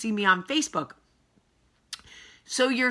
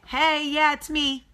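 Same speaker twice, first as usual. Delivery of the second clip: high, bright sarcastic hello; mock cheery greeting; 6 semitones higher than usual. Repeating her hello to the staring couple, sarcastically cheery. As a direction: call over with fake friendliness. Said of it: "So I said".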